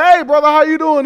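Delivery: high, loud mock-hearty hello; cheery greeting, imitated. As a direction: act out a wide-awake, hearty phone greeting. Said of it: "It wasn't like".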